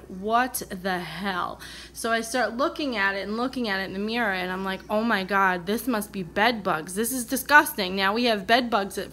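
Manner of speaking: ordinary talk, nothing marked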